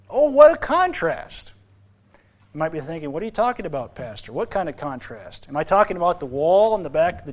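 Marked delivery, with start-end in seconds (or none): 0.1-1.5 high, slow, drawn-out wonder; high, slow cry of wonder; soaring exclamation. Real, not acted. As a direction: lift it up in awe. Repeated with higher pitch.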